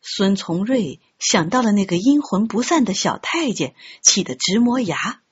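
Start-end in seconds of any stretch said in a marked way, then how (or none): none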